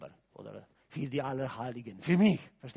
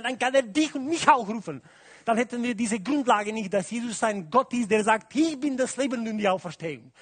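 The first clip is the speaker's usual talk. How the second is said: louder, higher preaching at full force; 6 semitones higher, 8 dB louder than usual. Acted. Preacher stirring the congregation about the resurrection, full-force preaching. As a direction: drive the point home to the congregation.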